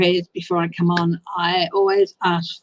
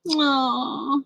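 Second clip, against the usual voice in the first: teary; 6 semitones higher, 4 dB quieter than usual. Real, not acted. Crying heard.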